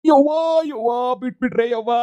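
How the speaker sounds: high, loud, slow cry of pain; a loud, drawn-out ouch; pained wail. Acted. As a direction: wail like you're being thrashed. Husband cries out in pain.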